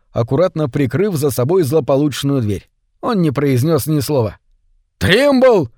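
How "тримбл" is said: much higher, loud summoning shout. A narrator's reading. The director shouts Trimble back, loud thundering call. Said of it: "закричал директор".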